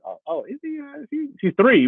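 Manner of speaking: high-pitched, startled self-quote; astonished dad. Retold or imitated. Dad reacts to three-year-old outrunning him, high and astonished. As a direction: gasp it out high, in mock alarm. Acts out shock at fast toddler.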